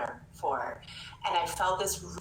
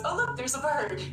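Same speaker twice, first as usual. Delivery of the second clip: higher, breezy, oblivious distractor voice; distracted distractor; 8 semitones higher than usual. Sinking-boat story, the distractor ignores the hole, higher breezy voice. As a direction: chirp it out as if nothing is wrong. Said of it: "the distractor goes".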